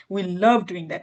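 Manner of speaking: slow line with "love" drawn out and falling; stress on love. Shown as a demonstration. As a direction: press hard on "love" to show how much. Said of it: "মানে আমি বোঝাতে চাচ্ছি যে এটা আমরা এত ভালোবাসি". ("love" টেনে জোর দিয়ে বলা)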